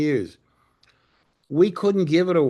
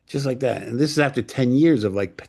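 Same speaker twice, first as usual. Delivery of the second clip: usual speaking voice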